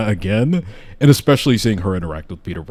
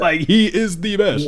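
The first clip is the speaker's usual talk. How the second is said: excited; 10 semitones higher than usual. Real, not acted. Gleeful agreement continues.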